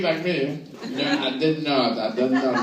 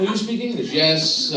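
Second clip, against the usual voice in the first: ordinary talk, nothing marked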